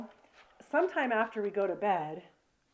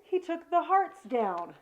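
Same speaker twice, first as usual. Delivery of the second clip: retold dismay; 6 semitones higher, slower than usual. Retells dismay at husband removing hearts.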